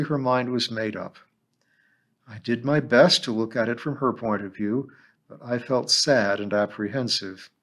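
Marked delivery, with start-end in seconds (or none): none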